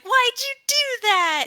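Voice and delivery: high-pitched indignant whine; mock outraged sister. Sister protests being kicked out, high mock outrage. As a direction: wail the complaint way up high.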